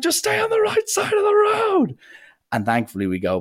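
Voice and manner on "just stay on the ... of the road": very high-pitched mock yell; hammed-up frantic screech; acted scream. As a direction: shriek it as a desperate passenger would. Passenger yelling at panicking driver.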